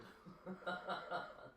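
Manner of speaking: airy high-pitched laugh; a quick unvoiced laugh; laughing at her threat; breathy high laugh. Real, not acted. Laughs after mock quote.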